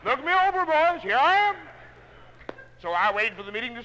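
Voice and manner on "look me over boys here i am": high, loud, swaggering; cocky boast. Retold or imitated. The salesman shows off to the other salesmen, high and loud. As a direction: strut it, look at me, boys.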